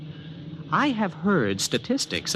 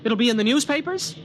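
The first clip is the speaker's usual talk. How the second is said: alarmed questions; 11 semitones higher than usual. Acted. Man told someone will die tomorrow, alarmed questions. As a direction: snap to attention, shaken by the warning.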